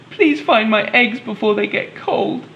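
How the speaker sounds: high, mock wail read to children. Helen at the door begging for her eggs, high mock wail. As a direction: lift high and wail it, storybook style. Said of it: "she wailed".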